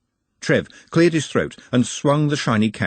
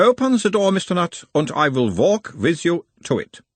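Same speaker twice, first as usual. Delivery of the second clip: put-on a mild Uberwaldian accent, the higher voice of a gentle examiner; 8 semitones higher than usual. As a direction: question him in a light Uberwald accent, calming the patient. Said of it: "in a mild Uberwaldian accent".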